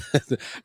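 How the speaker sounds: brief soft laugh mid-sentence; small amused laugh; chuckle. Real, not acted. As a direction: let a quiet chuckle interrupt you. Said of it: "I'm laughing because".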